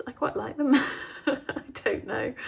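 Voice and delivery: laughs shyly through the words; embarrassed laugh. Real, not acted. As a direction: giggle it off, a bit embarrassed. Shy about her own work.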